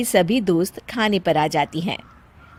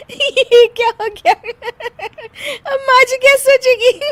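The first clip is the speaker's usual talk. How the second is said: high, louder worry broken by laughter; laughing worry; 12 semitones higher, 4 dB louder than usual. Acted. Daughter-in-law worrying about mother-in-law, laughing anyway. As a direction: fret while giggling. Bahu worried but laughing.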